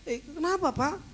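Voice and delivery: high, loud nurse's question; nurse asking. Retold or imitated. Nurse asking the shouting patient what he wants, high and loud. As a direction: ask like a startled nurse.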